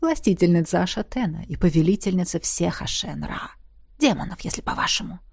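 low whispered guardian voice; hushed voice inside head; disembodied voice. A narrator's reading. Guardian explains.

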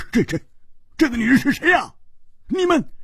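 fast, high flustered stammer; stammering shock. Acted. Hu Tiehua sees a strange dead woman, stammering in high shock. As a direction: blurt it out, caught off guard.

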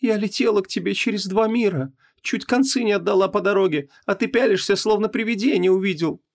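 high-pitched woman's complaint; reproachful wife. A narrator's reading. Wife to husband who stares at her, reproachful. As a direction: complain in a high, aggrieved wife's voice.

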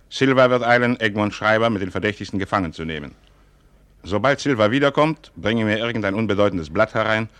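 loud and urgent, hammering out orders; commanding orders. Acted. Father orders his son to arrest the princes, loud and commanding. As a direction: drive each order home, high and loud.

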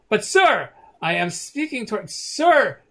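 a thug urging his lord to look; urgent. Acted. Thug urges Lord Anton to turn around, urgent. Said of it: "and says".

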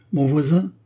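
cold, cutting reply; icy tone. Acted. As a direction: say it coldly, high and firm. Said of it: "d'un ton glacial". (d'un ton glacial)

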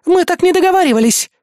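high, loud objection; indignant protest. A narrator's reading. Азуми calling after the hero, indignant. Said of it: "возмутилась Азуми мне вслед".

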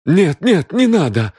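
high, loud, frightened plea; trembling plea. A narrator's reading. Хэй begs them not to call police, trembling. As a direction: plead loudly as if shivering. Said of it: "которого уже била дрожь".

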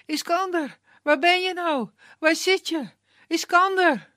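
high, loud calls through the woods; calling out. A narrator's reading. Annemaria searches the forest, calling loudly for Iskander. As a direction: shout for him into the dark forest. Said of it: "En ze roept".

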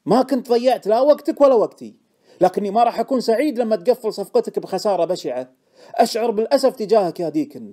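loud, high, reproachful regret; regretful. A narrator's reading. Informant taking leave of Deacon, aggrieved regret. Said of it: "قال بكل أسف".

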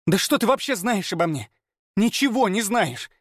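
put-on high hysterical girl's outburst; voiced girl shouting hysterically; hysterical cry. A narrator's reading. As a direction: scream it near tears. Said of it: "Истерично выкрикнула она".

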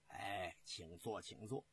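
low, soft and unhurried; hushed invitation. Acted. General seats three officers before explaining his plan, hushed low invitation. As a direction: ease them into their seats with a quiet, low voice.

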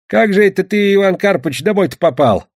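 put-on high, loud old peasant's questioning; old grandfather. A narrator's reading. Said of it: "спрашивал его дед".

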